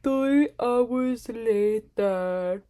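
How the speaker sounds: very slow, drawn-out cartoon narrator title card; SpongeBob narrator. Shown as a demonstration. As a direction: announce the time skip like the SpongeBob narrator. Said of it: "Did I sound like the guy from SpongeBob? That's what I was going for".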